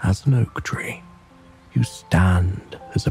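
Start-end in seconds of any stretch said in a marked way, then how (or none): none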